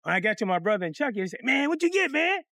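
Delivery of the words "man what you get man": high-pitched, impatient acted question; eager questioning. Brother asks what he got paid, eagerly. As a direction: pester him excitedly, voice up high.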